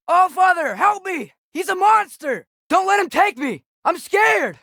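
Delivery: high, loud panicked cries for help; terrified screaming. Acted. Frightened child screaming to Father for rescue from monster. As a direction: scream for Father as if dragged away.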